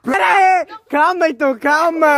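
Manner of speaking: high pleading call. Acted. Pleading in acted quarrel.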